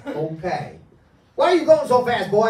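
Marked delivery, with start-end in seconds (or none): 1.4-2.5 Southern cop. Acted. Hypnotist playing the Southern cop, loud Southern accent. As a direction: bark it like a Southern sheriff. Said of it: "he speaks in a southern accent".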